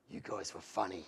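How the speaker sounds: low, soft gruff biker voice; menacing biker. Retold or imitated. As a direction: voice the huge biker, low and quiet. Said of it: "but he goes".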